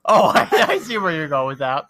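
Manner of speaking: laughing. Real, not acted. Still laughing at the twist.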